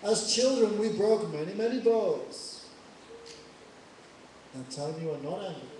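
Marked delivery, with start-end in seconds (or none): 0.0-2.6 taunting. Acted. Lakshman taunting Parshuram.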